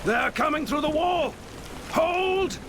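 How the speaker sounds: high urgent warning shout; alarmed battle cry. Acted. Battle cry as wall breached.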